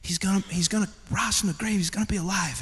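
put-on mother quietly comforting her boy; imitated calming mother. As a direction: gently soothe an upset child. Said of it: "she calmed him down".

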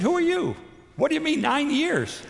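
high-pitched, bewildered questioning; puzzled reply, pitched well up; retold bafflement. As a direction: act out being thrown by a stranger's words. Retelling his baffled reply to stranger.

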